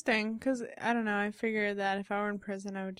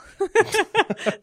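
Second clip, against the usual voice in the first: loud laugh; 12 semitones higher, 12 dB louder than usual. Real, not acted. Laughing at own joke.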